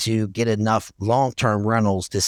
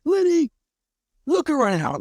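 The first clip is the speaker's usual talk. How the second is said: rich mentor's voice. Retold or imitated. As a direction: play the big investor showing off the venue. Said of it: "And he talks like this".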